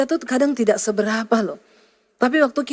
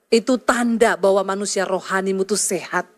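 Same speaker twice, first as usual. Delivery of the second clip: ordinary talk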